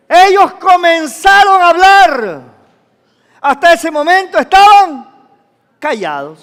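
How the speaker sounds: high, very loud proclamation; full-force preaching. Real, not acted. Preacher tells how the disciples began to speak, full force. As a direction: proclaim it to the whole hall.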